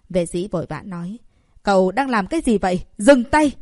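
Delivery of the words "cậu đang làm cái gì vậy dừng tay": high, loud alarmed shout; shocked outcry. Acted. Prince sees needles in his daughter, shocked loud shout. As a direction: yell to stop him mid-needle. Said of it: "kinh ngạc kêu lên thất thanh".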